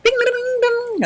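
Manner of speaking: very high sing-song dings; mock alarm bells. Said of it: "I get anxious, and I'm like".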